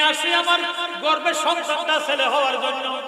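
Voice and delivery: high, loud chanted shout; defiant shout. Acted. The mother shouting at Salem Musa, high and loud. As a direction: hurl the words at him full force.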